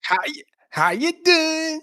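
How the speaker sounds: high, loud New York "How you doing?"; shouted New York accent on request. Shown as a demonstration. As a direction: belt the New York greeting. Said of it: "Say it for us".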